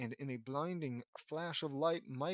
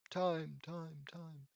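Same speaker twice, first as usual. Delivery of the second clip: fading echo; 3 semitones higher, much slower than usual. Acted. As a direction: imitate an echo dying away. Vanishing villain's words echo away.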